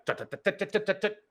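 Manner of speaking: rapid tut-tut burst of bullets; imitated gunfire. Game master sounding out bullets hitting glass, imitated gunfire.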